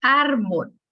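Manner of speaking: "Árbol" with the opening ÁR pushed harder; teacher showing the strong first syllable of "Árbol"; stress on first syllable. Shown as a demonstration. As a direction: lean on ÁR in "Árbol", let bol drop. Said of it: "con mayor fuerza de A".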